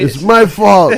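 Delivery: high, loud laughing mock girl voice; mocking girlfriend. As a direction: ham it up as her.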